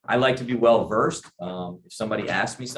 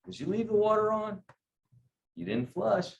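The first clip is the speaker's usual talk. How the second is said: questioning dad; 10 semitones higher, 4 dB quieter than usual. Retold or imitated. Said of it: "It's not an anger".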